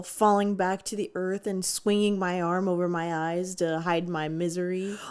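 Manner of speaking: ordinary delivery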